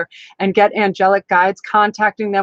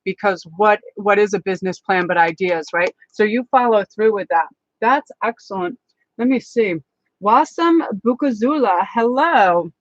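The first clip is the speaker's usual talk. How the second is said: ordinary delivery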